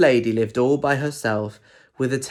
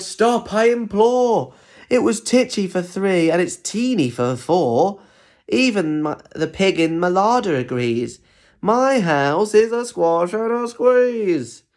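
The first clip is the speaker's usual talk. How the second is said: higher, slow imploring cry; desperate old lady; 6 semitones higher, slower than usual. Acted. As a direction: beg him to make it stop. Lady cries, animals everywhere.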